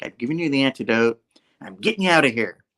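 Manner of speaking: usual speaking voice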